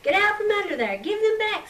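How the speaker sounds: high, loud angry scream. A narrator's reading. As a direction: scream it under the floor at them. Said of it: "screamed Martha".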